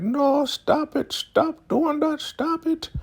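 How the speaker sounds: high-pitched, slowed mock wail; crying woman. Elder Mother begging Zane to stop, mock-crying high plea. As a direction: imitate a tearful plea in falsetto. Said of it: "she is crying".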